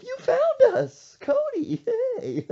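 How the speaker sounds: high, loud, slow laughing cover-up; fake cheerful laughing. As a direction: laugh it off like it's a game.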